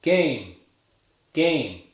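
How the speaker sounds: "game" with a clear gliding A diphthong; slow teacher model of "game"; model word game. Shown as a demonstration. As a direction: demonstrate "game", nasal vowel gliding into ee. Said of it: "how about game".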